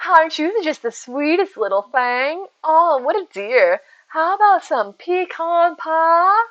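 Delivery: high, slow Southern belle drawl; syrupy Southern hostess, vowels drawn out; Southern belle accent. Shown as a demonstration. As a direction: gush sweetly in a hammed-up Southern accent. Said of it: "It doesn't sound right".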